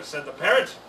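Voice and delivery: ordinary delivery, nothing marked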